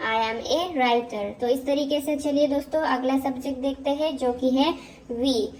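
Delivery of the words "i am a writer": very slow, word by word English model sentence; slow dictation. Shown as a demonstration. Teacher dictating slowly to students. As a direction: spell it out slowly so students can repeat. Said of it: "जैसे मैं एक लेखक हूँ".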